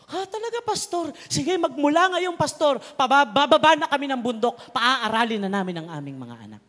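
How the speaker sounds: high, loud, eager villager; eager promise. Retold or imitated. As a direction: promise it with happy excitement.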